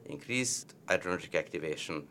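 usual speaking voice

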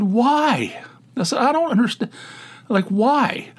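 high, baffled disbelief; incredulous. Retold or imitated. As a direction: ask why in utter disbelief. Incredulous questioning of friend.

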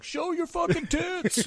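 high, slow drawn-out crowd yell; mock crowd yell. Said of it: "people are just like".

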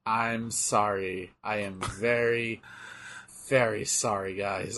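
slow, soft, overly apologetic; mock apology. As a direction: hang your head in a hammed-up apology.

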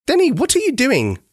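mother hollering at her son from the porch. A narrator's reading. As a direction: call the boy back sharply. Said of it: "his mummy hollered from the back porch".

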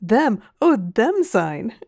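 scornful, incredulous burst of laughter; derisive laughter. A narrator's reading. Mister Ee mocking the captain's crew, derisive laughter. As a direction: laugh the words out in open mockery. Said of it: "burst into a shout of derision".

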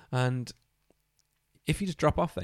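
usual speaking voice

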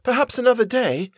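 high, tentative suggestion; timid plea. A narrator's reading. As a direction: venture it meekly, high-pitched. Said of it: "I said quietly".

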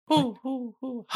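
high imitated owl hoots. Host answering the request for Hedwig's hoot, playful owl hooting. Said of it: "Hedwig's Digital Get Down hoot".

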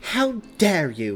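loud, high, slow outrage; outraged, drawn-out emphasis; spat out. Acted. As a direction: spit it out, deeply offended. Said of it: "Mary spat".

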